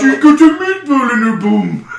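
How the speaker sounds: high, loud silly Swedish warble; Swedish Chef. Pretend. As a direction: belt it in cartoon Swedish. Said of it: "there is a Swedish Chef slash fiction".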